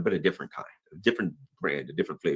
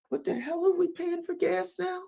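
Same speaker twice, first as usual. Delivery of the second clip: mocking complainer; 4 dB quieter than usual. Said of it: "are like".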